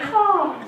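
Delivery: put-on caw, holding back for the sleeping baby; quiet caw. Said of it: "I don't wanna wake up the baby".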